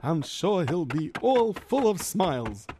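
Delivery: put-on worried Yiddish-accented kvetching. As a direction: complain like a hapless schlemiel. Figure narrates his mishap.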